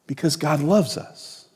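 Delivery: Because God loves us, dropped soft with loves still leaned on; soft repeat. Shown as a demonstration. Pastor repeating it softly to the congregation, loves leaned on. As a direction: let it fall softly.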